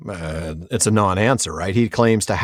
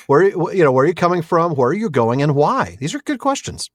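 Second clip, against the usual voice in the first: normal talking voice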